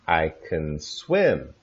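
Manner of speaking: I can swim slowly, can a weak kən, stress on swim; slow reduced can. Shown as a demonstration. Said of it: "Notice the sound is".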